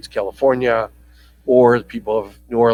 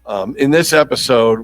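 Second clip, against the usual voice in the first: ordinary talk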